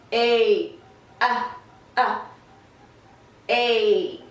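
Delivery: normal talking voice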